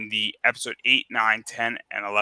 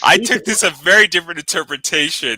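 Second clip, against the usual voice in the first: laughing; 6 semitones higher, 8 dB louder than usual. Real, not acted. Amused jab at Gage.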